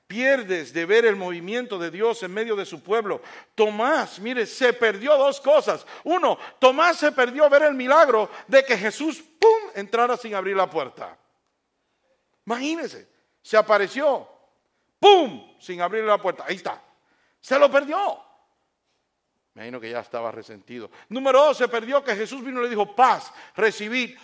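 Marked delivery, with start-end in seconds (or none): 0.1-18.2 loud, high, emphatic sermon; fiery preaching. Acted. Preacher rises to full force. 21.1-24.2 loud, high, emphatic sermon; fiery preaching. Acted. Preacher rises to full force.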